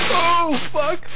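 sudden high loud curse; shocked curse. Real, not acted. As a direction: blurt it out in shock. Flipping car in game.

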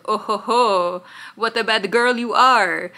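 hammed-up French seducer; bad French accent. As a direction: do a leering villain in a cheesy French accent. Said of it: "he has a shitty French accent".

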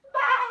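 high, loud wobbling baa; big bleating cry; lamb bleat. Pretend. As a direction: imitate a lamb for children. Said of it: "she makes a noise like this".

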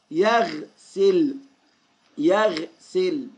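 يغسل very slow, split after the vowelless غ; teacher's slow model of يغسل, resting on the غ; halting on the sukun. Shown as a demonstration. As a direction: say يغسل in two parts, pausing on يغ. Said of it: "السكون يا أصدقائي معناها الوقوف".

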